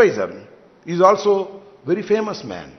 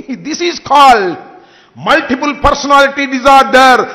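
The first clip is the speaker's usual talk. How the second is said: each word drawn out very slowly; slow, pointed, mocking label; slow mocking diagnosis; 3 semitones higher, much slower than usual. Real, not acted. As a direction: spell out the diagnosis word by word to mock.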